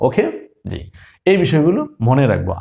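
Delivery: ordinary talk, nothing marked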